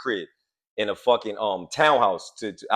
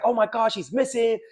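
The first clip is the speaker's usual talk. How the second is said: panicked mother; 11 semitones higher than usual. Retold or imitated. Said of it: "She's like".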